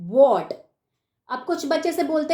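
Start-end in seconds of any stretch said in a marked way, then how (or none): none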